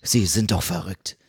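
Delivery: leiser, tiefer, leicht verstellt; fassungslose Figur. Acted. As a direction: ein Hauch von entsetztem Helden. Song Yi fassungslos. (leicht verstellte Stimme, leise)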